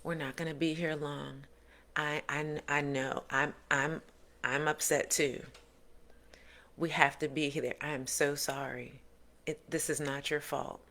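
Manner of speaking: put-on calm parent soothing a child. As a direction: gently comfort a child pulled aside.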